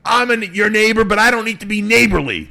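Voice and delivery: high, loud mock barking; barking neighbor. Neighbor refusing to be neighborly, barking at him. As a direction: bark it out like a hostile neighbor. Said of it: "barking at me".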